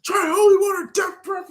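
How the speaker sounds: high shouted taunt; high mock shout at the vampire; mocking kid. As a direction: yell it like a cocky kid.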